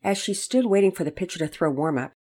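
plain reading voice

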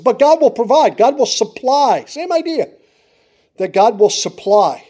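high, loud, ringing proclamation; full-force preaching. Acted. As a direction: preach it out with conviction. Preacher builds to a peak.

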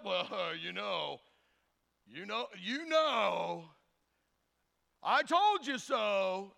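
slow, drawn-out know-it-all; hammed-up gloomy naysayer; Debbie Downer. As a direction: drag out a mock I-told-you-so. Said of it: "the Debbie Downer".

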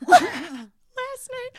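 high squeaky laugh through the words. Real, not acted. Laughing while quoting her roommate about last night. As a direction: break into laughter mid-quote.

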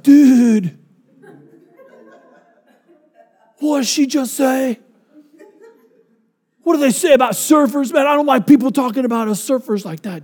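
high, laid-back surfer dude voice; a stoner surfer hurt by her words. Retold or imitated. Surfer husband offended by wife.

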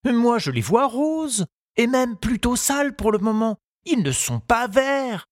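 lightly voiced young boy, pitched high and eager; a boy's light high voice, keen to finish; Tistou's voice. Tistou reassuring his gardening teacher, in a high boyish voice.

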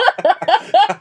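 high laugh. Real, not acted. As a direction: burst of high-pitched laughter. Laughs at own joke.

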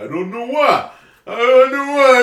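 a hint of Rocky, drawn out; Rocky impression. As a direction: mumble it like Rocky. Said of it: "I think you sound like Rocky".

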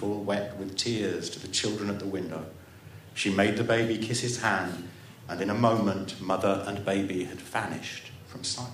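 ordinary delivery, nothing marked

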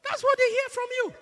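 high, loud preacher's shout; emphatic preaching cry. Real, not acted. Preacher scolding a silent congregation, emphatic high shout. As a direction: cry it out at full force. Preacher drives the point home.

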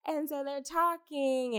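normal talking voice

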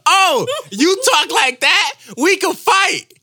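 loud, high outraged comeback; mock fighting challenge. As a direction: square up to the kid in mock outrage. Acted challenge to a rude boy.

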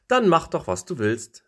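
high, loud dismissive shout; dismissive call. Acted. Other leaves giving up on the stubborn leaf, loud dismissive call. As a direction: toss it off and fly away. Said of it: "riefen die anderen Blätter".

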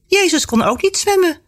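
put-on high, merry girl's voice; bubbly, high-pitched and playful; cheerful. A narrator's reading. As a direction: toss it out gleefully. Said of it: "zegt ze vrolijk".

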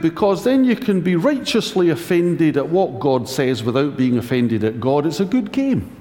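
normal talking voice

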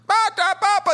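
high, loud mock babble; groggy babbling. Imitating patients in viral dentist videos, groggy high babble. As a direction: babble like someone half awake after surgery. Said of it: "where the person's like".

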